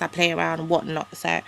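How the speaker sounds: ordinary delivery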